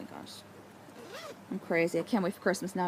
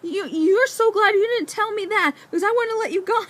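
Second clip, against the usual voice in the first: much higher, louder, scolding husband; protective outburst; 7 dB louder than usual. Retold or imitated. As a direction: scold her like a worried husband. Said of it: "And he was like".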